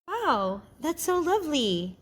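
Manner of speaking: lifted, grateful wow; cheerful and grateful. Acted. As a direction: sound truly pleased with the gift. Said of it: "cheerful and grateful".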